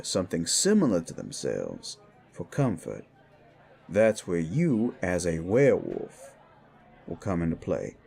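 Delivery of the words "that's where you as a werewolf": ordinary talk, nothing marked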